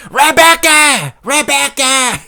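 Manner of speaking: high-pitched British yell, long vowels; Michael Bisping impression. Comic showing he has to yell it, drawn-out British impression. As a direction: holler the name out slowly and high. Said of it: "I have to yell it".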